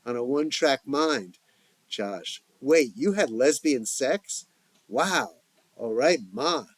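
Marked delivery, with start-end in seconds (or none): none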